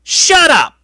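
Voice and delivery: very loud, high imitated outburst; mock yell. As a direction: bellow it like an enraged wrestler cutting someone off. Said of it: "speak, and he goes".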